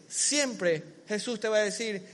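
ordinary delivery

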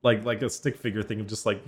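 ordinary talk, nothing marked

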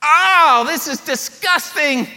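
high, loud, appalled inner voice; disgusted Simon. Acted. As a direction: recoil in disgust as the Pharisee. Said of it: "Simon says".

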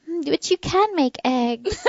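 imitating her daughter's polite consolation; child's voice. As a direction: daughter softening the blow, slow and high. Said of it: "And she went".

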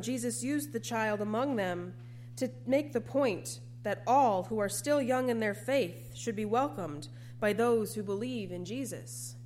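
ordinary delivery

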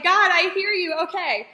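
excited, near-tearful relief; emotional outburst. Retold or imitated. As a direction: cry out to God as if finally heard. Emotional answer to God.

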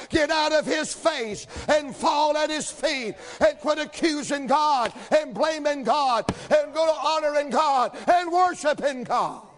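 high, driving revival shout; preaching at full force. Real, not acted. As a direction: build to a shouted, rhythmic sermon climax.

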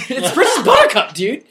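laughing through the name. Real, not acted. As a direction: laugh out the correction. Laughing correction.

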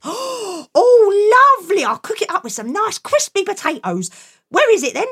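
high, thrilled mother voice; delighted mum. Acted. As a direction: gush with delight.

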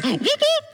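high whooping police siren sound; siren imitation. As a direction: mimic the siren sharply, way up high.

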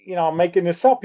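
usual speaking voice

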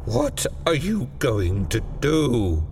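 put-on slow, hoarse, fearful old man; hoarse whisper. A narrator's reading. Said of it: "he whispered hoarsely".

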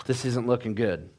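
low, hushed bad news; sigh, then say it low and soft; acted medic quietly losing hope; quiet grim verdict. Silent medic's grim verdict to dad.

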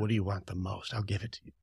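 low, soft insinuating offer; tempting devil. Acted. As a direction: murmur it like a tempter. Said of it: "He's not showing up like".

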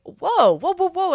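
high, loud retold whoas; astonished interruption. Therapist reacting to client's podcast news, astonished. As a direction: cut in with an astonished string of whoas.

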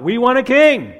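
loud, high demanding cry; a crowd demanding its king; loud insistent crowd cry. Acted. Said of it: "They cried out".

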